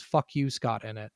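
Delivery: ordinary talk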